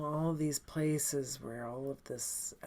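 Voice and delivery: usual speaking voice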